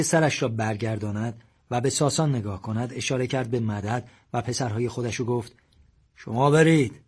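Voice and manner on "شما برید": firm order. A narrator's reading. Father orders sons away.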